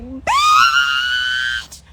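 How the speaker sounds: very high loud shriek; shrieked exclamation. Retold or imitated. Recounting her reaction to the speculum, very high shriek. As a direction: yelp it out in shock.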